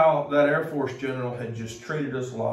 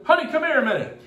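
high, louder call across the room; calling out to wife; 12 semitones higher, 5 dB louder, faster than usual. Retold or imitated. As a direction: call your wife over cheerfully. General calls his wife over.

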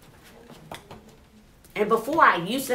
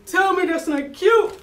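even higher, louder squeal; louder gush; 7 semitones higher, 4 dB louder than usual. Real, not acted. Teacher repeating herself, squealing louder over the topper. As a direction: push the delight further.

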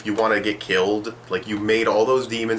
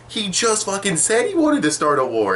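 exasperated; 12 semitones higher than usual. Real, not acted. Exasperated rant about dumb question.

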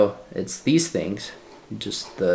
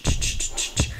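shaker sound; 4 dB quieter than usual. Retold or imitated. Imitating the shaker sample sound.

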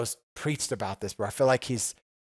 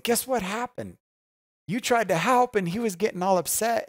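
high, agitated retelling of the gossip; mock indignant friend; 10 semitones higher, 4 dB louder than usual. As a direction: squeak it out like a worked-up friend passing on news. Friend retelling the upset story.

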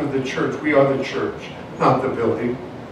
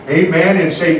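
usual speaking voice

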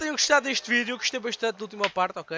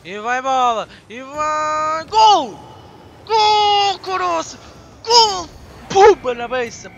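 screaming GOL, high and very loud; goal celebration; 5 semitones higher, 10 dB louder, slower than usual. Real, not acted. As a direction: erupt in goal joy like a commentator.

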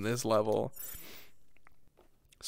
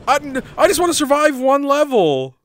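high, loud exasperated wail; desperate plea; 10 semitones higher, 7 dB louder than usual. Real, not acted. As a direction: beg the game loudly for mercy. Pleading with game after dying.

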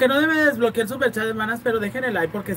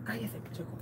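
whispered mock threat; 19 dB quieter than usual. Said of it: "Que llegaran y te dijeran".

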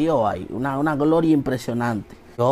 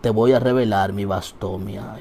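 lower, solemn voice from above; deep voice of God; 6 semitones lower, 5 dB quieter, slower than usual. Acted. God answers the praying comedian, lower and solemn. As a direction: speak as God, slow and low. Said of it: "esa voz profunda".

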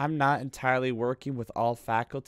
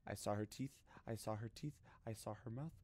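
very low, barely audible murmur; hushed fright; 7 semitones lower, 21 dB quieter, faster than usual. Real, not acted. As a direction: murmur it in shock, far softer. Frightened after jump scare.

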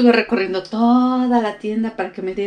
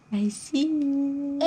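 very slow, softer stretched tease; imitating Toshiki; 6 semitones higher, 6 dB quieter, much slower than usual. Mom repeating dad's phrase to baby, slow soft tease. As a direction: breathe it out slowly, teasing. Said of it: "Eso siempre se lo dice Toshiki".

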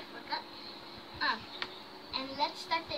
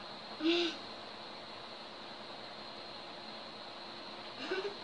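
breathy laugh through a full mouth; muffled laugh; 10 dB quieter than usual. Real, not acted. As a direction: laugh with cheeks stuffed. Laughing during challenge.

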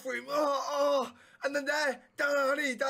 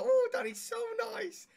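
mocking high voice; 13 semitones higher than usual. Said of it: "Don't sit here and go".

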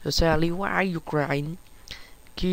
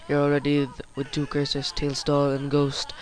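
normal talking voice

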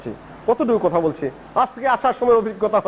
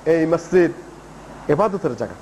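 low, measured and unhurried; calm, patient explaining; 6 semitones lower than usual. Retold or imitated. Prophet explains to a newcomer what a mosque is for, calm and patient. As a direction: gently explain it, pausing between phrases. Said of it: "ধীর স্থির হয়ে বুঝাইলেন".

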